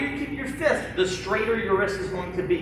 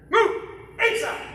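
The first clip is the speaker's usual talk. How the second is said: drill command shout; 12 semitones higher, slower than usual. Real, not acted. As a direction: snap the command out to the class. Instructor calling drill commands.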